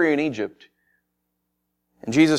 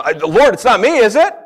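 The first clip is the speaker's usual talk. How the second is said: loud, rising, pleading question; anxious protest; 7 semitones higher, 7 dB louder than usual. Acted. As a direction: protest innocence, pitch climbing to the end. Disciples anxiously deny being betrayer.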